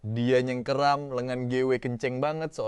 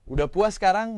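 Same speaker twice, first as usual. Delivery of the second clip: raised, higher challenge; sharp outburst; 8 semitones higher, 6 dB louder than usual. Acted. Vesper confronted over the wedding, loud. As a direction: throw it at her, loud and high.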